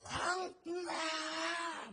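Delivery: put-on long, high, drawn-out drunken holler; high-pitched bawl stretched out; bellowing drunk. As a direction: imitate a drunk husband yelling in the street at dawn. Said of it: "고래고래 강을 치는 거예요".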